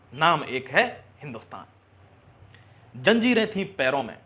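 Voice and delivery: rousing, emphatic verse with weighted line endings; stirring recited verse; patriotic poem recitation. Acted. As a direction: declaim it to the nation.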